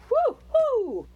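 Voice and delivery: high, loud woo-hoo; joyful whoop at the magic; excited whoop. Real, not acted. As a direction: cheer with delight. Cheering at magic dust.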